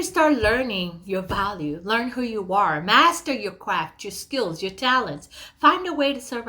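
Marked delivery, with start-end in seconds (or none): none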